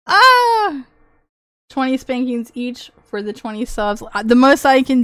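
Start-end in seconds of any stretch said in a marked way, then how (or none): 0.1-0.8 happy shriek. Real, not acted. Shriek of delight.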